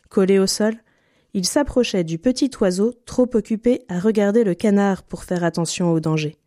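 usual speaking voice

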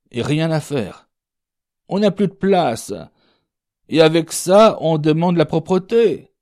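voix plus haute, indignée; râle haut et fort contre l'encombrement; personnage qui râle. Acted. (voix aiguë et indignée)

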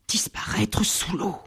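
whispered, fearful. A narrator's reading. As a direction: breathe it out scared. Scared crocodile.

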